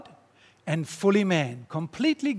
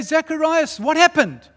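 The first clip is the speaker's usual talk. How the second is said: crowd calling out; 9 semitones higher, 7 dB louder than usual. Acted.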